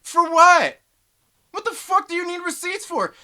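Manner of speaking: loud, high, disbelieving yell; incredulous shout. Real, not acted. Ranting about receipts.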